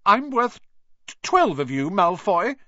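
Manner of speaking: high, loud stammered defiance; stammering. Acted. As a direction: stumble over twelve. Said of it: "he stammered".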